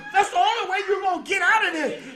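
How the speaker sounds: high, laughing self-encouragement; urging himself on. Retold or imitated. Preacher retelling his frantic fight, urging himself on, laughing. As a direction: cheer yourself on mid-brawl. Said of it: "But I said".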